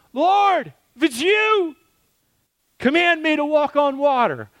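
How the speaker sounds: high pleading voice of Peter. Retold or imitated. Peter in the storm calls to the Lord, high and pleading. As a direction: cry out high to the Lord from the boat. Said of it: "they're freaking out".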